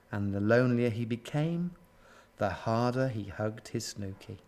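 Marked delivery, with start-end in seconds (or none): 2.4-4.4 soft, low storytelling; hushed, lonely narration; subdued. A narrator's reading. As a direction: let it sink down quietly. Sad refrain, first time round.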